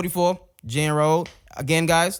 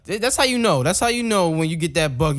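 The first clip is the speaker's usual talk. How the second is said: normal talking voice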